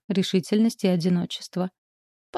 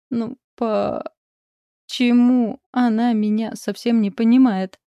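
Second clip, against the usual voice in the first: acted slow, drawn-out tearful whine, higher and louder; sobbing child; 4 semitones higher, 4 dB louder, slower than usual. A narrator's reading. Upset little girl complains about her mother, sobbing. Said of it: "всхлипнула Ксюша".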